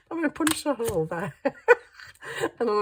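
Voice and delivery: laughing. Real, not acted. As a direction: laugh while saying it. Laughing at her own crafting.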